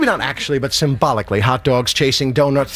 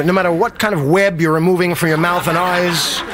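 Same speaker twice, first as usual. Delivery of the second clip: ordinary delivery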